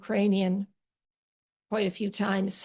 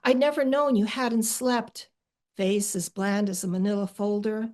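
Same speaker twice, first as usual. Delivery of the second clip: poem reading. Acted. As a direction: read it gently to a sister. Reading her own poem aloud.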